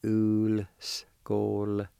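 Ulskoltane said slowly, syllables stretched and level; slow pronunciation demo. Shown as a demonstration. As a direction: stretch each syllable of Ulskoltane, slightly linked. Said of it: "like this".

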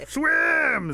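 high, loud, stretched-out yell; urgent shout. Acted. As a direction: holler to someone in the water. Rabbit shouting Otter away.

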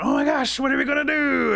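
high, loud scream of panic; panicked screaming. Acted. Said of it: "Otter's screaming".

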